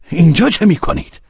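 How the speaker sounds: high, loud challenge; sharp demand. A narrator's reading. Man running up to the carriage demanding answers, sharp. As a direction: fire the question out loudly. Velchaninov confronts Pavel at carriage.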